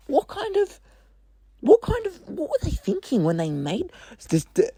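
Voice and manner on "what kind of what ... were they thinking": exasperated squeal. Real, not acted. Ranting at bad game design.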